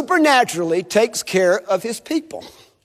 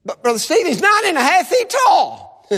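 higher-pitched incredulous protest; objecting listener; 8 semitones higher than usual. Acted. Congregant objects to preacher about Goliath's height, incredulous protest. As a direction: blurt it out as a disbelieving objection.

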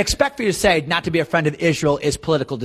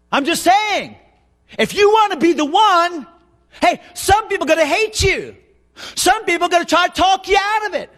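impassioned preaching; 11 semitones higher, 4 dB louder than usual. Real, not acted. Preacher charging young Garrett to speak God's word, impassioned preaching. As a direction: preach it with rising urgency.

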